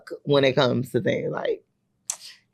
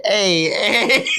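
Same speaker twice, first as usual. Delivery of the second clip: laughing. Real, not acted. Laughing at daughter's 9/11 remarks.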